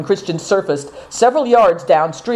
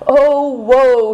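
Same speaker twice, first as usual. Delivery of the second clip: higher, louder hammed-up wail of woe; mock lament; 7 semitones higher, 4 dB louder than usual. A narrator's reading. Naidong laments his lost hat, hammed-up louder wail. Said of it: "he cried".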